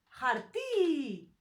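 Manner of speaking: harti with a long, stressed "ti"; stress on final syllable. Shown as a demonstration. Teacher showing where the stress goes, harti stressed on a drawn-out ending. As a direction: stretch and push the last syllable of harti. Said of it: "we put it here".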